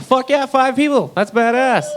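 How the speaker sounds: high, slow, mock-excited cheer; sarcastic high celebration; mock hype. Real, not acted. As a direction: hype up five people with fake glee. Mock hype for tiny crowd.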